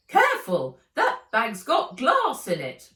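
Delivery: loud, sharp roar; roaring warning. A narrator's reading. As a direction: boom the warning across the snow. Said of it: "roared Fred".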